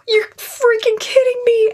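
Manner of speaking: high squeal of delighted disbelief; thrilled disbelief. Retold or imitated. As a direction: gasp it out in shocked joy, way up high. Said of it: "I was like".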